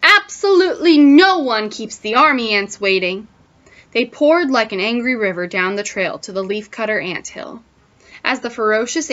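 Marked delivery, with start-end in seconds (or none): 0.0-3.3 higher, loud and emphatic; outraged military proclamation, starting at full force; lieutenant's shout. A narrator's reading. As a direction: shout indignantly to rally the troops. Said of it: "shouted the lieutenant".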